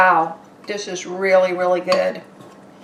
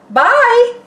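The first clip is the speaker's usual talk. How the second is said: bright goodbye; 13 semitones higher, 7 dB louder than usual. Real, not acted. Cheery sign-off.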